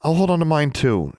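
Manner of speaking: defiant. A narrator's reading. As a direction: refuse him, standing firm. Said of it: "she said defiantly".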